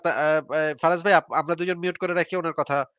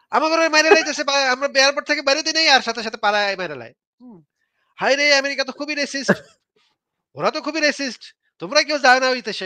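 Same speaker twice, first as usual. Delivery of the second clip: mock public appeal; 8 semitones higher than usual. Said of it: "গিয়ে কেন বলেন না যে".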